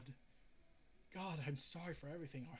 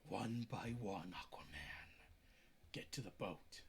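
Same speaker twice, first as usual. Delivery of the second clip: dying whisper; 10 semitones lower, 3 dB quieter than usual. Acted. Dying low voice.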